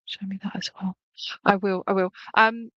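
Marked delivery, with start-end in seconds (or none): 0.1-1.0 softer, secret whisper. Retold or imitated. Medium voicing the spirit's hushed request, whispered.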